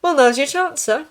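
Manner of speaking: high, loud, put-on cheer; falsely bright encouragement; forced cheerfulness. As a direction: try to sound cheerful for a patient. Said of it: "He was trying to sound cheerful".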